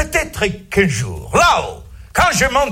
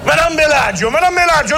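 higher calling out a patient's name; 7 semitones higher, 3 dB louder, faster than usual. Acted. Doctor calls out to the pregnant patient, higher and louder. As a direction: call her name across the ward.

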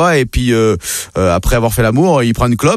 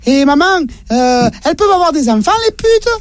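voix d'enfant très aiguë et curieuse; petit garçon; 14 semitones higher than usual. Acted. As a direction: jouer un petit garçon qui interroge sa mère. (voix de petit garçon très aiguë)

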